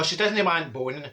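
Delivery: ordinary delivery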